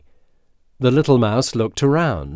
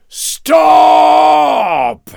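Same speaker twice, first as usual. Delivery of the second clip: long, high roaring shout; lion's roar; 10 dB louder than usual. A narrator's reading. Woken lion stopping the dancing mouse, roaring. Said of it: "the lion roared".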